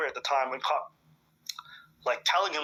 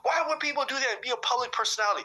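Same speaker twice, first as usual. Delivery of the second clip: incredulous; 9 semitones higher, faster than usual. Acted. Rhetorical disbelief.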